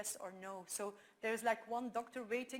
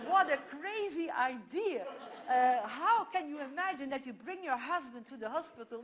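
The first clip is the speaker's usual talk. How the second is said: laughing mockery; 4 semitones higher, 7 dB louder than usual. Retelling how the ladies laughed at the idea, laughing. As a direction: retell their mocking laughter. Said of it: "those ladies were laughing so loud".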